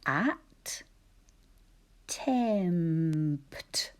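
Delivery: "At", long pause, drawn-out "tempt"; syllable by syllable. Shown as a demonstration. Teacher introducing a new word, "At tempt" in two slow, separate syllables. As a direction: sound out "At" and "tempt" separately, every consonant heard. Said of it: "Think about those sounds and syllables".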